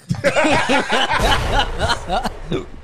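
loud, high burst of laughter; helpless loud cackling; big laugh. Real, not acted. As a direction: crack up hard, rolling laughs. Cracks up at own joke.

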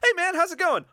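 high, chirpy fan greeting; high friendly greeting. Pretend. Acting out greeting fans.

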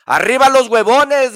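high, loud rallying yell; loud mocking cheer of a lazybones; lazy man shouting. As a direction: shout it like a rousing battle cry. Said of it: "gritaba el perezoso".

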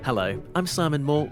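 ordinary delivery

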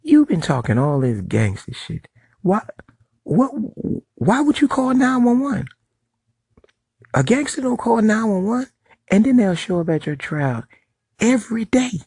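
high mocking whiny boss voice; mocking the smacked boss. Mocking the boss who called the police, high whiny voice. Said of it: "the smacky".

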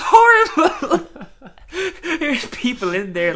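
high, slow laughing words. Real, not acted. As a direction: squeal it through laughter. Laughing at tire fire scene.

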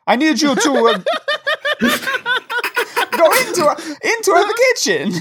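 high mock Italian accent, laughing through it. Ghost asks the helpers into the kitchen, mock Italian and laughing. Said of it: "make me do an accent".

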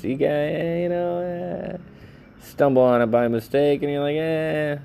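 mock a bored listener; mocking high voice. Host parodying accidental listeners, high goofy tone.

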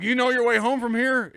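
loud, high called-out question; retold shout. Friend asking before he drives off, loud high shout. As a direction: holler it as the hurried friend.

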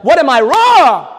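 high, very loud demand to yourself; loud, worked-up self-challenge; forceful self-questioning shout. Acted. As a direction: shout it as if forcing yourself to ask. Forcing himself, shouted.

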